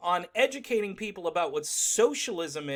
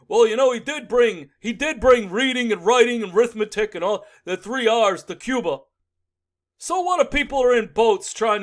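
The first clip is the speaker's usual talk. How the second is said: louder, put-on Bernie voice; mock Bernie; 5 semitones higher, 9 dB louder than usual. Mocking Bernie excusing Castro's Cuba, put-on and loud. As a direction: defend Castro like a stubborn old man.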